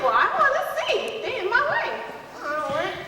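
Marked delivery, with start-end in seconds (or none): 0.0-1.9 much higher, laughing nosy complaint. Retold or imitated. Nosy girl blocked by the crowd, high and laughing. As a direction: push in to see, amused. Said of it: "And I'm like".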